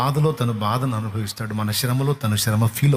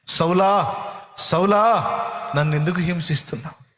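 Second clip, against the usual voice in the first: calling out; 6 semitones higher than usual. Acted. Jesus calls out to Saul.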